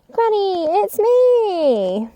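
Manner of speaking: put-on high, bright child voice calling out; high girl's voice calling to granny; little girl calling. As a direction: call out as a small girl at the door. Girl calls out in story.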